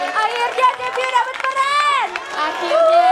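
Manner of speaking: excited teasing cheer. Real, not acted.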